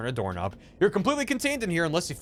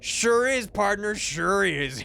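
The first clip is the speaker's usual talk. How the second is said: hammed-up western twang, higher; cowboy voice; 5 semitones higher, much slower than usual. Gabby answering as a cowboy, hammed-up twang. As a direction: drawl it like a cartoon cowboy. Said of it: "Gabby doesn't talk like that".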